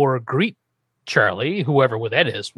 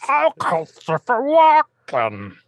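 hammed-up guttural mock impersonation, drawn out and higher; badly faked celebrity voice, slow and exaggerated; Christopher Walken; 9 semitones higher, much slower than usual. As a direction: stretch each word into a guttural parody. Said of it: "guttural sounds you call a Christopher Walken impersonation".

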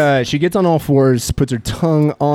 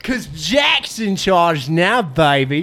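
swagger as a wasteland boss with a wobbly Australian twang; a hint of an Australian accent; British-Australian accent; 7 semitones higher than usual. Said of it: "You sounded British at the start".

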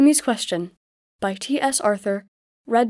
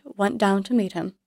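ordinary delivery

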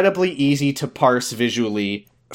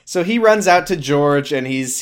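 normal talking voice

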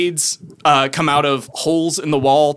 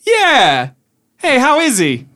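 high-pitched, chatty gnome voice; casual banter, pitched well up; gnome sorcerer; 9 semitones higher, 3 dB louder than usual. Acted. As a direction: pipe up as a little gnome sorcerer.

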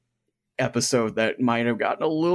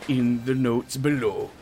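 pirate voice; 2 semitones higher than usual. Acted. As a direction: ham it up like a sea pirate. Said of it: "I'm gonna have to do the credit pirate voice".

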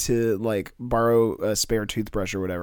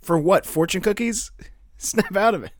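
laughing; 8 semitones higher than usual. Real, not acted. Laughing at the pitched scene.

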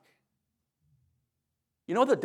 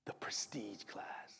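slow, hushed reveal; drawn-out, reverent whisper; awed whisper; 7 semitones lower, 18 dB quieter, slower than usual. Shown as a demonstration. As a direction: whisper it like a luxurious secret. Reveals the luxury model, hushed.

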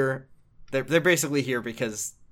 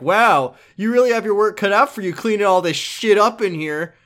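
loud, high, fake-impressed exclamation; exaggerated mock amazement; 7 semitones higher, 7 dB louder than usual. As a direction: ham up the surprise at the mess. Oda's acted quote to squatter.

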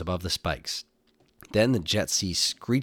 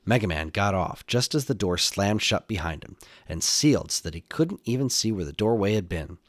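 plain reading voice

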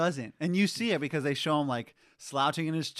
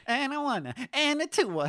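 high imitated count-in; conductor voice; 7 semitones higher than usual. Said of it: "That, that's his voice".

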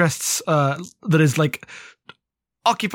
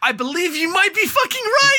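excited shout. Real, not acted. Co-host names the book and she shouts in excited recognition. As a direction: yell it out as the penny drops.

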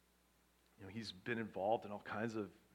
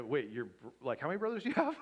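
surprised disbelief; 3 semitones higher, 5 dB louder than usual. Retold or imitated. Retold shock at friend's news.

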